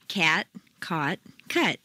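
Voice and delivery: Cat, Caught, Cut with three distinct vowels; vowel contrast demo. Shown as a demonstration. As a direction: model Cat, Caught, Cut slowly and clearly. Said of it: "Chapter three".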